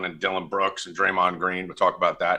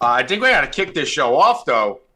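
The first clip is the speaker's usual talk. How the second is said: ordinary talk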